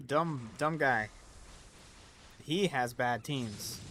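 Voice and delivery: drawn-out mocking jab; slow mocking. Real, not acted. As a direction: stretch it out slowly, teasing. Mocks a player's name.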